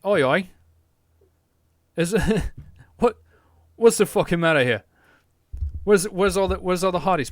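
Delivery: hammed-up British lad accent, shouting across the street; mock British lad. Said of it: "people are gonna come into Britain and be like".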